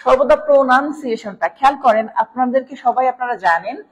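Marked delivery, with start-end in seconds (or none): none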